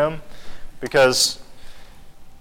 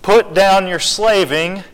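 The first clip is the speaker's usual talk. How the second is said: louder and higher, pressing every phrase; rising sermon fervor; preaching at full force; 5 semitones higher, 4 dB louder, slower than usual. Acted. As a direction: plead with the hypocrites to come in. Preaching climax to Pharisees.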